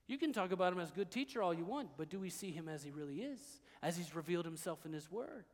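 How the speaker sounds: ordinary talk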